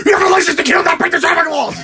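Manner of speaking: high, loud hammed-up accusation; mock accusing shout. Angry M scolds Bond, high hammed-up shout. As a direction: scream the scolding like an outraged boss. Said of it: "They have him accusing".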